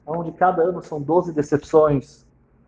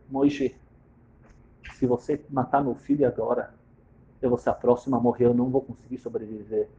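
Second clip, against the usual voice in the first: softly in his ear; 6 semitones lower, 7 dB quieter than usual. Acted. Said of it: "fala nos ouvidos dele".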